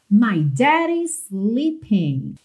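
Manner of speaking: "daddy's" with tapped D's, drawn out; slow American model of "daddy's sleeping"; Americanized. Shown as a demonstration. Teacher modelling a lyric, slow American "daddy's". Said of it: "daddy ganhou esse sotaque mais americanizado".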